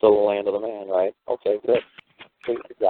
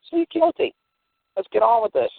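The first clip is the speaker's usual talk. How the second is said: even higher squeaky begging; mock plea; 13 semitones higher than usual. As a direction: urge him in a shrill hurry, pitched right up. Mock pleading high in court.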